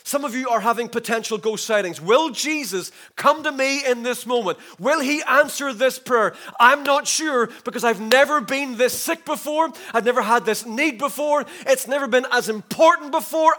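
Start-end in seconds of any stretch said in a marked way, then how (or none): 2.0-13.5 desperate doubt. Acted. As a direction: preach it as a believer's urgent doubt. Voices a doubting believer in need.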